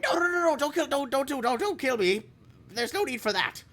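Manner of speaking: high, panicked begging for life; terrified pleading. Acted. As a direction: squeal out desperate pleas. Goblin begs not to be killed.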